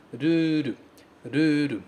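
"ruru," with a tapped R and a long final u; Japanese ruru. Shown as a demonstration. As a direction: model "ruru," with flap Rs and a long u. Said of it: "ela é longa". ("ruru" com R batido e u longo)

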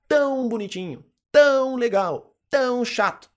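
"tão" stretched long, high and loud; emphatic "tão". Shown as a demonstration. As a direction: lean hard on every "tão". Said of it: "pra dar ênfase".

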